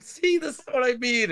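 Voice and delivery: very high exasperated guard impression; high-voiced retold exasperation; mock exasperation. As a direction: pitch it way up, making the point. Retold exasperated guard proving point.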